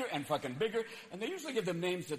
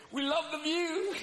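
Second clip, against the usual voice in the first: mild dreamy homeowner; 9 semitones higher, slower than usual. Acted. Homeowner answering.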